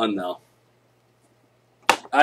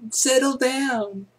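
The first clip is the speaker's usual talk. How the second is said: much higher, slow settle-down voice; prim teacher hushing a class; teacher calming class; 13 semitones higher, much slower than usual. Shown as a demonstration. Teacher hushing a rowdy class, high and slow. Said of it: "teachers can't say quiet".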